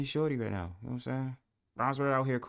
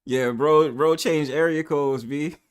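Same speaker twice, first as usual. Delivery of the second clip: ordinary talk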